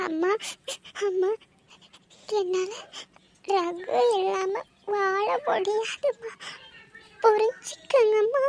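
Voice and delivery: high, slow, tearful begging; pleading. Acted. Daughter begs mother to let her marry Ragu, pleading.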